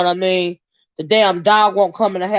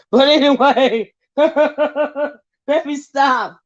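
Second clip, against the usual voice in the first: laughing through the words, slow and high; can't stop giggling, trying to stop; 6 semitones higher, much slower than usual. Real, not acted. To her viewers, giggling as she tries to stop. Cracking up at her own story.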